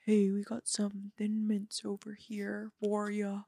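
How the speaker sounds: light diva impression, slightly held vowels. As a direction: attempt a famous singer's speaking voice. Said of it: "channel, channel share".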